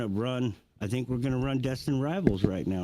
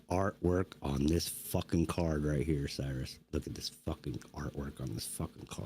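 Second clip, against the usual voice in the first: hushed awe; 7 semitones lower, 5 dB quieter than usual. Real, not acted. Admiring card, hushed.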